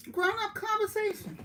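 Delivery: high, squeaky emphasis on grown-up conversation; grown-up conversation jumps way up in pitch; emphatic squeal. Real, not acted. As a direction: pop the phrase up high to make the point. Stressing the point of the chat.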